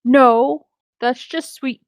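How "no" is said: ordinary delivery, nothing marked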